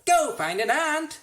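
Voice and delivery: high, loud brush-off; shouted order. A narrator's reading. Boy shouting at the starving anteater, loud and dismissive.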